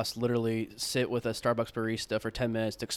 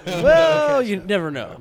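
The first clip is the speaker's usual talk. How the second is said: big mock-dramatic shout, pitched way up; playful loud exclamation; 10 semitones higher, 11 dB louder, much slower than usual. Cohost joking to friends, loud mock-dramatic shout. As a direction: throw it out loud like a wild maybe. Joking about ketchup in coffee.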